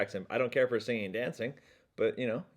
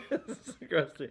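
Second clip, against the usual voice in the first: laughing; 13 semitones higher, 6 dB quieter, much slower than usual. Pretend. Mocking word, laughing.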